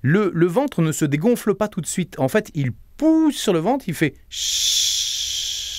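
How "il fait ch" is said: slow, whispered, drawn-out sh; long sh hiss. Shown as a demonstration. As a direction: push the air out on a long sh. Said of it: "vous allez faire le son che".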